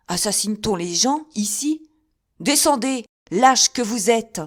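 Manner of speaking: loud, high, furious challenge; angry shout. A narrator's reading. Athos yelling up at the castle wall, furious. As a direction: call the cowards down to fight.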